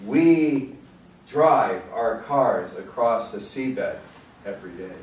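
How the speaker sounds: projected recital, slowing into the final line; deliberate, weighted phrasing; poetry reading. Acted. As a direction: read it as a poem, letting the ending settle. Reads a poem aloud.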